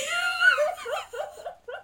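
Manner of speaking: high squealing laugh. Real, not acted. Reader laughing at her own yell, high squealing laugh.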